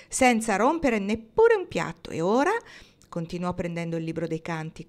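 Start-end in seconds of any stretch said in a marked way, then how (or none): 0.1-2.5 louder, proud boast; emphatic, self-satisfied announcement. A narrator's reading. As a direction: show off the achievement. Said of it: "annunciò Pocket molto fiero".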